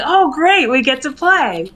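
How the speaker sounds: high, gushing delight; eager playful. Pretend. Player answers the walrus high and gleeful. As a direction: squeal at the chance to play. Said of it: "So I'd be like".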